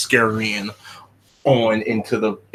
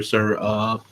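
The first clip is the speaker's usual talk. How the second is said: ordinary talk, nothing marked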